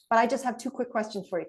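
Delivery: fast, brisk delivery; speedy, to-the-point pitch; rapid quick ask. Shown as a demonstration. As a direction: rush through it to keep them on the line. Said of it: "really very quickly saying".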